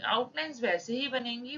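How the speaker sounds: ordinary talk, nothing marked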